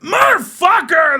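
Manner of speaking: put-on high, loud, drawn-out shout of a boy; kid's yell. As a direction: let it rip like a kid finally allowed. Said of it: "He's like".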